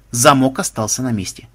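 ordinary delivery, nothing marked

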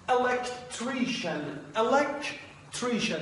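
electrician said slowly, high, each syllable clear; teacher models electrician for Persian students, slow and careful; model pronunciation. Shown as a demonstration. Teacher demonstrating electrician slowly for the class. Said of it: "کلمهٔ اول".

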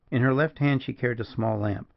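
ordinary delivery, nothing marked

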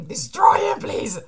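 urgent whisper-shout. Real, not acted. Cheering character on.

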